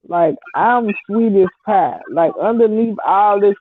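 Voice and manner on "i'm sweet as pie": ordinary delivery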